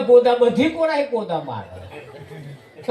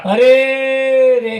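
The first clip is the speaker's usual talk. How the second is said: long drawn-out, louder "અરે રે"; mock wail; 3 semitones higher, 6 dB louder, much slower than usual. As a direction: stretch it slowly like a hired mourner. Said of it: "રડવાનું ચાલુ કર્યું".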